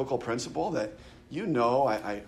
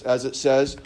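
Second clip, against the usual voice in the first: normal talking voice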